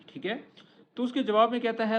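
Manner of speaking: ordinary delivery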